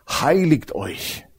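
Heiligt euch drawn out very slowly; solemn, very slow Heiligt euch as the Lord's word; slow solemn quote. Shown as a demonstration. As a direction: stretch every syllable of Heiligt euch. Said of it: "sagt der Herr".

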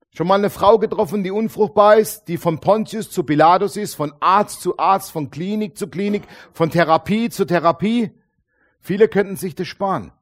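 loud, driving preacher voice; forceful preaching. Acted. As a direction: preach it out to the crowd. (mit voller Kraft gepredigt)

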